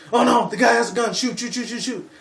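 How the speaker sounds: high, loud panicked yelling; mock a cop freaking out and firing. Vlogger imitating a panicking cop, high shouted panic.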